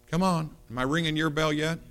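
usual speaking voice

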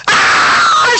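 very high breathy scream of terror; wife screams as she is pushed off the cliff; retold scream. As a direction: let out a falling jumper's shriek. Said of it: "She screams".

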